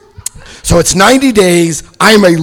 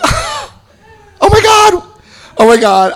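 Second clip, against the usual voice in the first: very high, drawn-out wail; mock horror; 3 dB louder, much slower than usual. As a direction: wail in exaggerated horror, then come down. Horrified by addicts' room.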